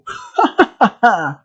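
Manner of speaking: crazed clown cackle; mock maniacal laugh; maniac laughter. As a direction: burst into a villain's cackle after the threat. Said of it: "screaming and laughing".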